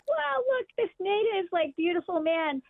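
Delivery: high-pitched awed wow, easing down; retold gushing. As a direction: re-enact being starstruck by a saint. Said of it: "gushing. I'm like".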